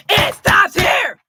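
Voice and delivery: loud, high, slow put-on voice; impersonation demo. Shown as a demonstration. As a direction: bellow it slowly in a big borrowed voice. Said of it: "I'm actually changing my voice".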